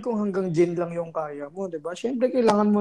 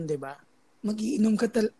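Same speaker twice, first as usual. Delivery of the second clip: normal talking voice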